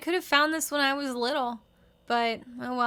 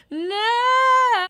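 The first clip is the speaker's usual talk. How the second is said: shocked no; 11 semitones higher, 8 dB louder than usual. Real, not acted. Reacting to mech suit.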